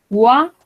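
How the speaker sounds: "Oa" voiced as a clear "wa" glide; teacher isolating the "Oa" sound; model sound. Shown as a demonstration. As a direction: show the "wa" sound of "Oa". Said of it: "vamos a pronunciarlo como U A".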